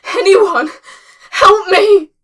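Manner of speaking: very high, loud yelling; desperate cry for help. Acted. Limping woman to anyone who hears, desperate yell. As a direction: call out at full voice for rescue. Calling out for rescue.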